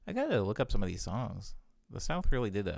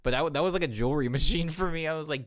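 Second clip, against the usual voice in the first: ordinary talk, nothing marked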